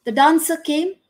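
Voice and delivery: ordinary delivery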